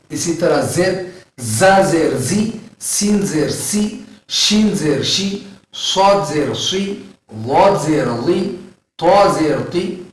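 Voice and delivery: letters with zer said slowly, syllable by syllable; slow zer drill. Shown as a demonstration. Teacher drilling zer syllables for students, slow. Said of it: "زبر اور زیر اور پیش کی حرکتیں".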